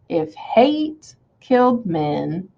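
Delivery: slow, lilting "If hate... killed men" in paired beats; scansion test. Shown as a demonstration. Teacher checking the stress pattern, slow exaggerated beats. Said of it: "that almost sounds like stressed".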